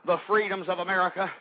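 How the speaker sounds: ordinary talk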